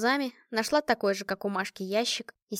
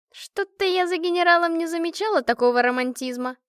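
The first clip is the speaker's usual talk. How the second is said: higher, louder teasing disbelief; amused skepticism; 8 semitones higher, 6 dB louder than usual. A narrator's reading. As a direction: needle your friend with mock surprise. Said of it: "Машка усмехнулась". (насмешливо, с недоверием)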